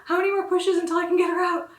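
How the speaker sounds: mother asking the doctor, urgent and eager; eager urgent question. Retold or imitated. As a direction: push the doctor for an answer. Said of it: "I looked at my doctor and I was like".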